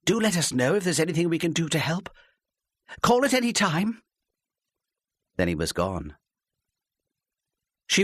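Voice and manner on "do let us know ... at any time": overly friendly, higher and bright; gushing. Acted. Wife gushing to the policeman at the door, overfriendly. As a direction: gush at the departing policeman. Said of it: "almost gushing".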